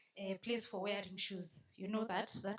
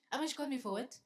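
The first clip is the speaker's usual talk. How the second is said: normal talking voice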